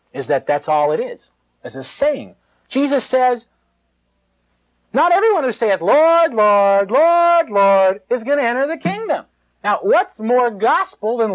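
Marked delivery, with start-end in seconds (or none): 4.9-9.3 louder and higher, proclaiming Lord, Lord; preaching Jesus' words. Acted. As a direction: thunder the quote like a revival preacher. Preacher quoting Jesus forcefully.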